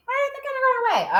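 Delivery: squeaky-high, quick echo; very high, fast put-on voice; high-pitched answer. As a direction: answer yourself in a tiny high voice. Voices an answer to own question.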